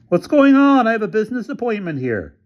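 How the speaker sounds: a rich man put out by police; puzzled developer. A narrator's reading. Developer questioning police at the gate, indignant and high. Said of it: "The developer rolled down his window".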